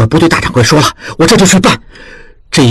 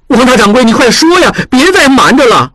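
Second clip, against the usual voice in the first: put-on higher, pressing voice of a young apprentice; lightly voiced anxious apprentice; urgent young apprentice; 9 semitones higher than usual. A narrator's reading. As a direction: urge him to stop hiding it. Apprentice voice, pleading.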